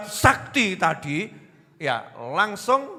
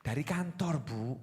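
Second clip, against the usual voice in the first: much softer, calm husband; gentle reply; 3 semitones lower, 12 dB quieter than usual. Retold or imitated. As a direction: answer softly and politely to calm the wife. (suami menjawab lembut dan sopan)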